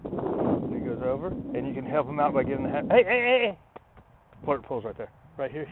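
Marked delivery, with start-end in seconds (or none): 2.9-3.6 sharp call. Real, not acted. Trainer stops dog pulling away.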